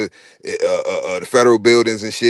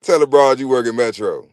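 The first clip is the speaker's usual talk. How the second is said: ordinary talk, nothing marked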